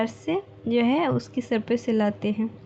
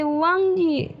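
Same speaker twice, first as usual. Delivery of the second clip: annoyed whine; 7 semitones higher, much slower than usual. A narrator's reading. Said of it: "चिढ़ते हुए बोलते".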